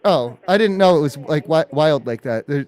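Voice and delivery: normal talking voice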